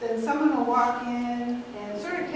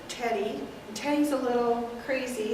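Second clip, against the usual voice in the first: ordinary talk, nothing marked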